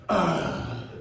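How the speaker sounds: mock old man groan; old man grunt. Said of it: "the old man grunt when you sit down".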